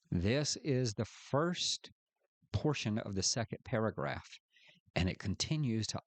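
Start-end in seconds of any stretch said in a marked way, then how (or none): none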